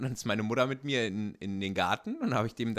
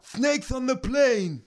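movie quote voice; 13 semitones higher, 6 dB louder, much slower than usual. Pretend. Quoting movie line in character voice.

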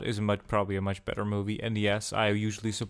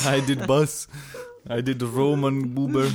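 caveman speak; 2 semitones higher, 6 dB louder, slower than usual. Pretend. Mocking broken caveman talk.

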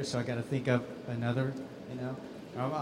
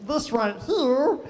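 monster voice; 10 semitones higher, 4 dB louder than usual. Shown as a demonstration. Voice actor demos his chicken monster voice. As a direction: greet them like a goofy giant baby chick. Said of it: "talking like this".

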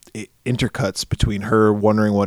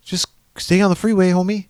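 mock advice; 8 semitones higher than usual. Mocking advice to character.

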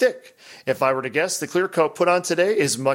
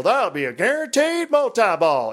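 put-on southern accent; 7 semitones higher than usual. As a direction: imitate a southern showman's one-liner. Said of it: "He's got a southern accent".

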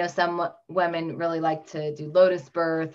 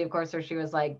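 ordinary talk

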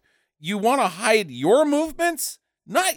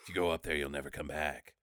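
very low, very soft warning; hushed warning; 15 dB quieter, faster than usual. Acted. Podcast host mock-warning about going up there, hushed and low. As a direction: drop right down to an ominous murmur.

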